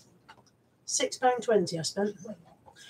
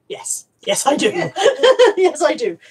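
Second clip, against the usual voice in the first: laughing reply; 6 semitones higher, 7 dB louder than usual. Real, not acted. Answering Mandy, laughing.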